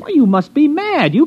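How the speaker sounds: shoots up high, scandalised; can't believe what he's hearing; indignant disbelief. Acted. City man refuses the farmer's offer, shocked and indignant.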